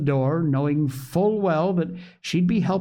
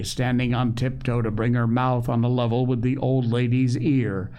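usual speaking voice

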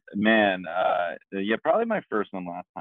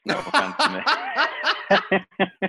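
slow, much higher, breaking into laughter; laughing; 12 semitones higher, 4 dB louder, much slower than usual. Real, not acted. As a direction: drag it out as laughter takes over. Joking about destroying cohost.

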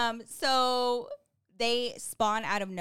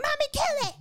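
put-on very high, loud cry of a petrified little girl; panicked child; 8 dB louder than usual. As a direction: imitate a frightened daughter begging mom for help. Imitates scared daughter begging her.

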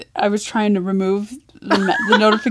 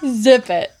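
laughing. Real, not acted. Laughing fit while recording.

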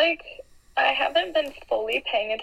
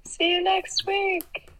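high sign-off; 8 semitones higher, 4 dB quieter, slower than usual. Real, not acted. Sign-off line.

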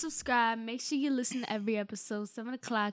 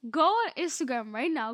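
ordinary delivery

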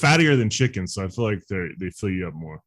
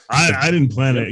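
ordinary talk

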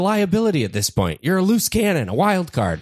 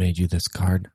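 lower, deadpan guest voice; low odd voice; 7 semitones lower, 3 dB quieter than usual. Acted. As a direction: hand it over with a low deadpan. Odd retreat guest hands card.

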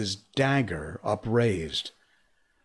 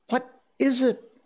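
put-on high, alarmed boy's question; distressed boy, pitch leaping up; frightened cry; 14 semitones higher, 4 dB louder than usual. A narrator's reading. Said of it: "he cried again".

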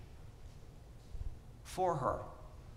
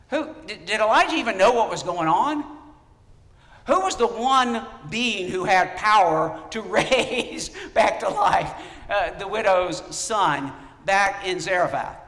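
loud, high, fervent sermon climax; preaching at full force; 4 semitones higher, 8 dB louder than usual. Acted. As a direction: drive it home to the congregation.

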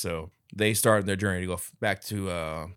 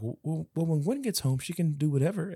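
lightly voiced little man; 8 semitones higher than usual. Tiny husband talking about Gwen, lightly high. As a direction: pipe it up gently. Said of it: "he says something like".

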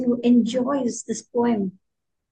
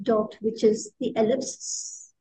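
ordinary talk, nothing marked

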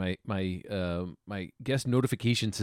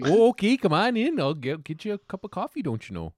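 folksy, lilting neighbor with a higher voice; hospitable Harold; 8 semitones higher than usual. Acted. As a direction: usher a friend in with folksy, singsong hospitality. Harold offers coffee.

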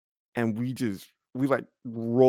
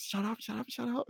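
hushed mock shush; 11 semitones higher, 8 dB quieter than usual. Friends hushing them.

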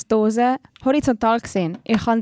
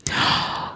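shocked gasp; 4 dB quieter than usual. Real, not acted. Shocked reaction to crude joke.

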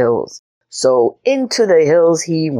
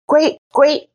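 little knight reciting his lineage; boyish shout; 11 semitones higher than usual. A narrator's reading. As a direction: shout each great like a proud boy.

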